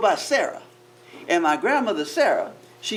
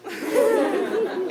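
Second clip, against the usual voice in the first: high, breathy amazed gasp; gasp of awe; 11 semitones higher than usual. Shown as a demonstration. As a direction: gasp at the view. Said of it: "you went".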